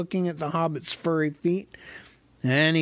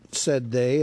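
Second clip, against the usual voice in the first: plain reading voice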